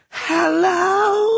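put-on very high, wavering old voice; frail warbling old man. A narrator's reading. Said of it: "a frail voice warbled".

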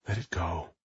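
muttered under the breath, very soft; to himself. Acted. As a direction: quietly talk yourself down. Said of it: "he told himself".

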